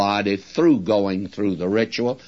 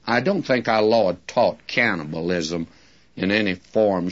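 ordinary talk, nothing marked

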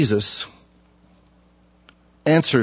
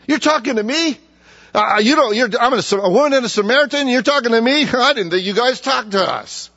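much higher, fast mocking disbelief ending in a laugh; rude incredulous woman; 10 semitones higher than usual. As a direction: scoff at him, pitched up and quick. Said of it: "this woman has a lousy attitude".

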